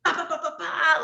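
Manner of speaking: high, rapid-fire "ba" noises for punches; imitated punch flurry. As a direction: mimic a barrage of blows.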